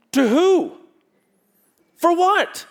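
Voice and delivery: high, loud, demanding questions; challenging questions. Acted. Preacher challenging a vague churchgoer, loud and demanding. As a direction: press them to say to who and for what.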